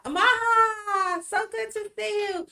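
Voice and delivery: high, delighted hello to a friend; light up as you spot her arrive; excited greeting. Real, not acted. Host greeting a newly arrived viewer, high and delighted.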